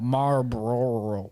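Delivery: slow, stretched Southern drawl on "Marlboro". Real, not acted. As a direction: let "Marlboro" melt out in a lazy Southern drawl. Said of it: "That's the Southern drawl coming out".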